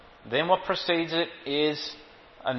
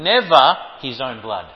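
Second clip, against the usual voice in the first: usual speaking voice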